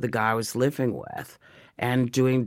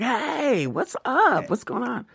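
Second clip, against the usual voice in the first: much higher, chirpy "hey, what's up"; casual mock greeting; 13 semitones higher, slower than usual. Picking up the phone to Mr. Nichols, chirpy and casual. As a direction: play it breezy and carefree. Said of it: "I was like".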